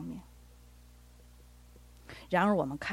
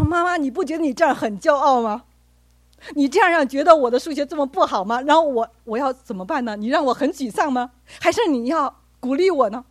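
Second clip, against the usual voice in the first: louder, higher, hurt protest; upset son; 6 semitones higher, 8 dB louder than usual. Retold or imitated. Son protests angrily at his mother over her remark. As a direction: push back hard at mum, hurt. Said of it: "我儿子就很生气".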